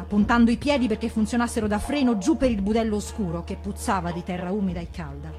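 plain reading voice

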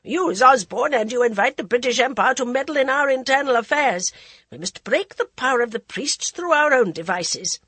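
loud, commanding anger; angry queen. A narrator's reading. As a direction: scold him with royal fury. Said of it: "interrupted angrily".

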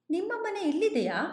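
curious. A narrator's reading. Driver asking the hitchhiker about his house, curious. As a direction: ask it wondering where the house could be. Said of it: "ಕುತೂಹಲದಿಂದ ಕೇಳಿದೆ".

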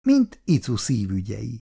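ordinary delivery

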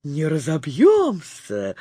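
low, slow, unbothered character voice; confident reply. A narrator's reading. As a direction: brush off the panic, drawing it out. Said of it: "уверенно отвечала Лола".

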